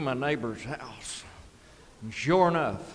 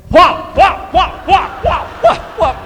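loud, much higher whop whop of a dog shaking a rabbit; shouted whop whop imitating the dog; dog thrashing noise; 13 dB louder, slower than usual. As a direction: belt out the thrashing sound. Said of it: "and he is going".

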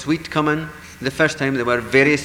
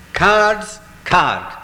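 commanding call; 6 semitones higher, 6 dB louder than usual. Acted. Fairies command the cards.

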